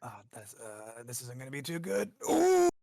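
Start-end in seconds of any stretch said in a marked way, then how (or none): 2.3-2.7 a loud, high wince of dismay; pained high ooh. Real, not acted. Player groans at rolling a one, loud high ooh. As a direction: yelp at the awful roll. Player reacts to bad roll.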